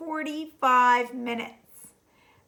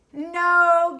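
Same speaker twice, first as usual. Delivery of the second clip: a louder gasped no, out of breath from laughing; 3 semitones higher, 6 dB louder than usual. Acted. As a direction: gasp the word between laughs. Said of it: "gasped the rhinoceros".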